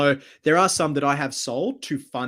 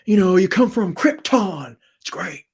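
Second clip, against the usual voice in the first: higher, louder bad Nick Cage; Nick Cage again; 7 semitones higher, 6 dB louder than usual. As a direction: ham up a Nick Cage line. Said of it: "my awful Nick Cage impression".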